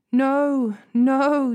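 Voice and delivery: a desperate cry of protest. A narrator's reading. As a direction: Tamar crying out to her brother in anguish. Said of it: "Tamar cried".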